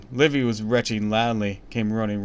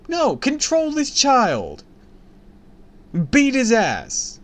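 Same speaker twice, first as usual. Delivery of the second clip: very high, slow and loud; exasperated outburst; 6 dB louder, slower than usual. Real, not acted. Reader fed up with the brat, high and slow. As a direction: drag each word in disbelief.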